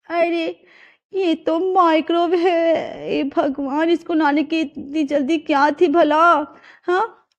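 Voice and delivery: the trap just sprang on her; dismayed surprise. Acted. Bride seeing the microwave, slow dismayed surprise.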